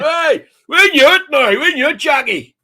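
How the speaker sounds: loud, very high mimicked crowd shout; cheering Northern Irish crowd. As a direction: whoop it like a vindicated Belfast audience. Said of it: "And they went".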